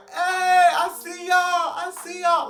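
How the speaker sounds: high, loud, slow shouting; drunken shout. Retold or imitated. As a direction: shout through the window at them. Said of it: "banging on that mug".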